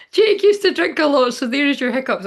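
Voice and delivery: high laughing through words. Real, not acted. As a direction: laugh as you say it. Laughing at hiccup joke.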